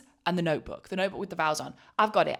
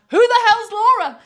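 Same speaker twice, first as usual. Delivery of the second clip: high, loud, slow disbelieving shout; outraged shout; 14 dB louder than usual. Retold or imitated. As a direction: yell it at the altar in disbelief. Retold outrage at wrong name.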